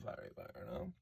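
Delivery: ordinary talk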